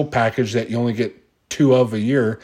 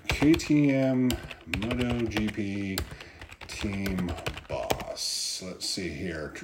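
softer, reading under his breath; muttering to himself; 3 semitones lower, 11 dB quieter, much slower than usual. Real, not acted. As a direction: mutter the search terms to yourself. Muttering while searching online.

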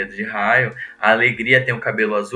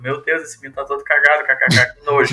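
laughing while disgusted; 4 semitones higher, 6 dB louder than usual. Retold or imitated. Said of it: "você fica rindo".

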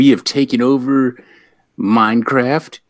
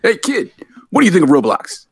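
TV host voice; 8 semitones higher, faster than usual. Pretend. Host calling on a kid.

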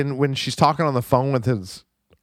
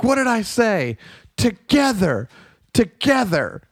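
higher, insistent, separated words; mock choir director; 8 semitones higher than usual. Podcaster imitating director correcting choir, mock insistent. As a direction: drill it like a hammed-up conductor. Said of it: "And that's his only note".

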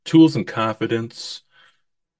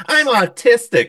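raised, louder mocking whine; mocking quote; 8 semitones higher, 6 dB louder than usual. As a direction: parody an attention-seeking claim. Mock quote of internet men.

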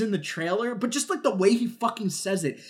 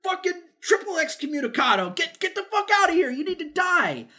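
higher, fast, hammed-up angry rant; a mock exasperated outburst, higher and quick; mock furious High Table; 8 semitones higher than usual. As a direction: ham up the outrage. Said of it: "now they're like".

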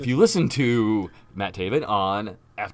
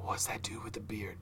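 whispering; 3 semitones lower, 17 dB quieter than usual. Real, not acted. Gossiping about an ugly player.

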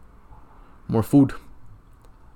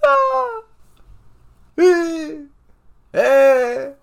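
put-on old lady voice; much slower than usual. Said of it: "I can do a better old lady".

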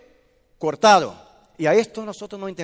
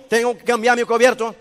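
father stating; 4 semitones higher, 7 dB louder, faster than usual. Retold or imitated. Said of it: "el padre de Sansón dijo".

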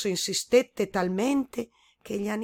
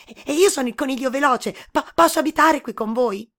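fast, higher, loud voice tripping over itself; a breathless rabbit in a hurry; quick stuttering rabbit; 6 semitones higher, 9 dB louder, faster than usual. A narrator's reading. Rabbit asking to move in, fast and stammering.

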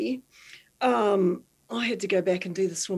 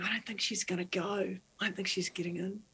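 much softer, half-heard doubt; overheard crew murmur; 12 dB quieter than usual. Retold or imitated. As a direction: quietly relay what the crew muttered. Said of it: "I could hear the boat crew saying".

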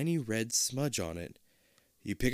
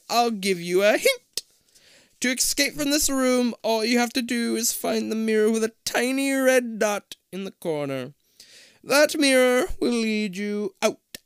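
hollow echoing voice; 11 semitones higher, 7 dB louder than usual. Acted. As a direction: echo it out like a ghost in the walls. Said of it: "a hollow voice".

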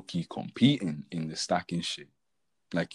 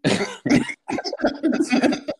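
real high, loud laugh; 6 dB louder than usual. Real, not acted. Introducing the first topic to co-host, high loud laugh.

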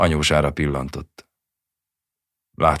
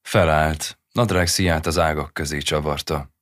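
usual speaking voice

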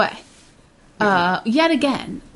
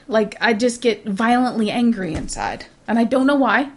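normal talking voice

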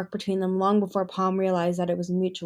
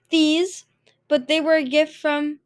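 dismayed; 8 semitones higher, 5 dB louder, slower than usual. Acted. Palm shocked at demand.

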